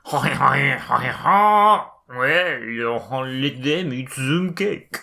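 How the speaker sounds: mock French accent, laying it on thick. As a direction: ham up a bad French accent. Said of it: "should I do that with French accent".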